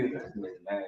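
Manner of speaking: hushed grossed-out murmur; disgusted muttering. Retold or imitated. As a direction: recoil in quiet disgust. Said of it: "You gonna be".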